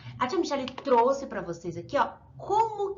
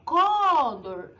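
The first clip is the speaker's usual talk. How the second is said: Condor with DOR stretched out and raised; stressed last syllable; 5 dB louder than usual. Shown as a demonstration. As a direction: hit the last syllable of "Condor", long and loud. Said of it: "Última sílaba tônica".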